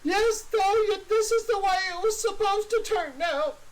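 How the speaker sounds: high, tearful confession; crying Clevis. Acted. Clevis to his captor, high and weepy. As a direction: sob it out while justifying.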